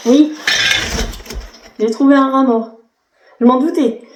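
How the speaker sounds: startled, pitch jumping up; real surprise at a find; surprised. Real, not acted. Finds a dead rat.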